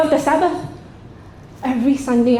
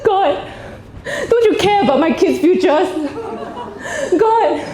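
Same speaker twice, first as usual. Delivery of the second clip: desperate prayer; 7 semitones higher than usual. Retold or imitated. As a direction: wail at God in mock despair. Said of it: "I say, "God".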